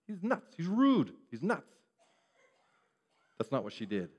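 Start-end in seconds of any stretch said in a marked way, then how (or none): 0.1-1.7 hammed-up gossip warning the crowd; mock indignant slander. Woman slandering Jesus to others, mock indignant. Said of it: "slander him".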